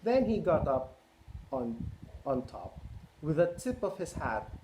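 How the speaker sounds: lively picture-book read-aloud; reading to children. A narrator's reading. Parent reading Cat in the Hat to children, lively. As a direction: read it out to a roomful of kids.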